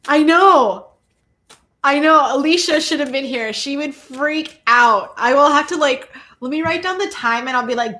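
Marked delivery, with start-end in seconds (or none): none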